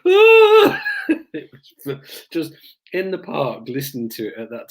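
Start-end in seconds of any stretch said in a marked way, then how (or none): 0.1-0.8 very high, loud mock howl; resume the pitiful wailing; imitated prisoner's wail. Prisoner starts wailing once more after being told off, howling. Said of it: "the prisoner is still going".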